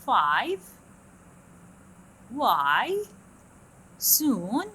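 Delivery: each word dipping then rising; fall-rise demo. Shown as a demonstration. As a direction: model the dive tune. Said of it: "شوفوها".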